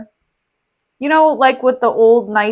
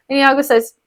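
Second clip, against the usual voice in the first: ordinary talk, nothing marked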